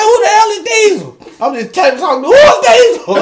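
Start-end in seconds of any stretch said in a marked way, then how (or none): 0.0-1.2 high, loud comic disbelief; bewildered and played for laughs; baffled retold question. Acts out his alarmed bafflement. 2.3-3.2 very high, loud comic yelp; hammed-up alarm; baffled retold question. Acts out his alarmed bafflement.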